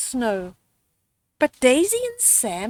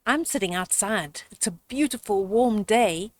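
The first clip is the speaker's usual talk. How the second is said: plain reading voice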